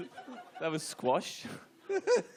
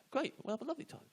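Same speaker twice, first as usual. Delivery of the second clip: overeager reply; 11 semitones higher, 5 dB quieter, faster than usual. Retold or imitated. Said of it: "Did you actually say".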